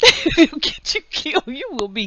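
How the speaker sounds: high, loud words through laughter; laughing hard. Real, not acted. Vlogger warning what conservatives would do, laughing hard. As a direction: barely get the warning out for laughing.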